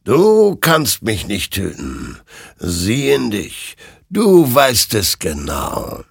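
slow, drawn-out otherworldly pronouncement; slow, weighty spirit voice; spectral rider, commanding. Acted. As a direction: draw out every word with knowing authority.

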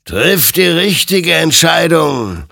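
loud, high, drawn-out warning; admonishing. Acted. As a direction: spectre urging a choice, slowly and forcefully. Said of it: "ermahnte ihn sein Gegenüber". (eindringlich mahnend)

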